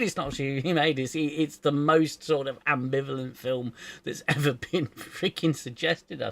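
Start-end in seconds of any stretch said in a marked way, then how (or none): 0.0-1.0 mock retraction. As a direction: settle down into a plainer denial. Mimics the contradicting announcement.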